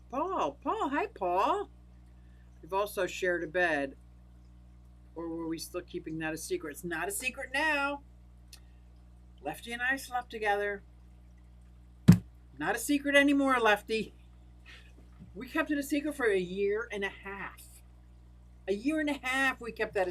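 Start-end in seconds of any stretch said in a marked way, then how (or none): 0.1-1.7 high sing-song calling to a puppy; puppy greeting. Real, not acted. Greeting her puppy on camera. 12.6-16.9 louder, faster, higher teasing announcement; gleeful reveal. Real, not acted.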